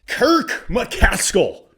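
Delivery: name stretched out slowly, ringing high; slow announcement. Acted. Vlogger presents the next card, stretching the name slowly. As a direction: draw the name out like a stadium announcer.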